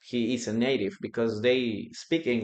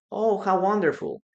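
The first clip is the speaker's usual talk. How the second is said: higher, admiring exclamation; delighted congratulation; 8 semitones higher than usual. Shown as a demonstration. Replying to someone who made a movie, delighted. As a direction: react gladly to someone's big achievement. Models reaction to someone's achievement.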